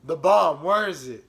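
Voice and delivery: loud demanding "The bomb"; cop demanding. Retold or imitated. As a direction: bark it out like a cop. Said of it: "so he's like".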